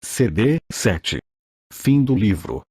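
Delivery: normal talking voice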